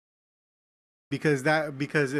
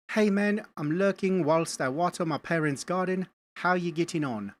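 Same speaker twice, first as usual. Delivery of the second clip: put-on British accent, lightly hammed up; 3 semitones higher than usual. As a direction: read it out in a playful British accent. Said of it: "that's not my best British accent".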